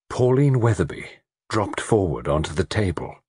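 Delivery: plain reading voice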